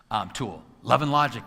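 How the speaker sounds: ordinary talk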